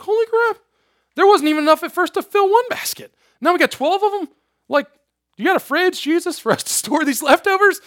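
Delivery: very high-pitched, astonished disciples; mock amazement. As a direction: squeak out their disbelief, pitch way up. Disciples astonished by twelve full baskets.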